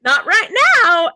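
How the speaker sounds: high, loud mock refusal; defiant human side. Human side puts off the signs, loudly defiant. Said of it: "my human side is like".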